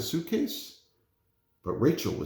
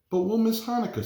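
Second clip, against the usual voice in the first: put-on girl pleading; 12 semitones higher, 3 dB louder than usual. Girl character pleads in story.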